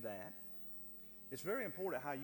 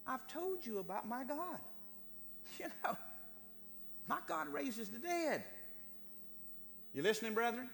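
full-force preaching; 8 semitones higher than usual. Acted. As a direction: preach it out with fire.

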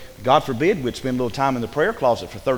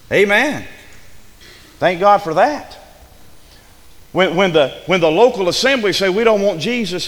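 raised, emphatic pulpit delivery; fervent preaching; 5 semitones higher, 5 dB louder than usual. Real, not acted. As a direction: preach it out with conviction.

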